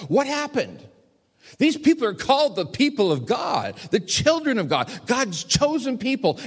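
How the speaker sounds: high, loud, fervent appeal that builds; impassioned preaching. Real, not acted. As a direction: preach it with rising fervour.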